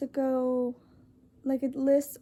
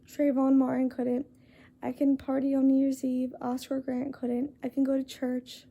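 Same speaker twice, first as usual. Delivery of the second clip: normal talking voice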